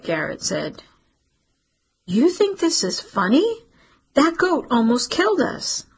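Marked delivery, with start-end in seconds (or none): 2.1-5.9 high, indignant disbelief; indignant outburst. Acted. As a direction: throw his joke back at him, outraged. Cece furious at Garrett's joke.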